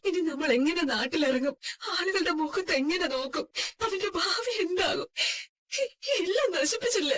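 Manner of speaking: tearful, despairing lament; crying. Acted. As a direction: lament through sobs, voice breaking into tears. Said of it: "കരയാതെ".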